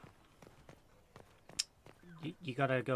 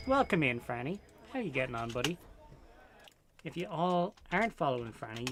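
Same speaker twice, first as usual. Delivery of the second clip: usual speaking voice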